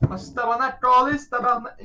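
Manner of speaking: high, put-on Québécois French cursing; Québécois swearing. Friend mocking how Americans hear Québécois kids swear, high Québécois French. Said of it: "all you hear from the fucking, in the background is like".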